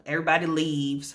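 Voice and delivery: ordinary delivery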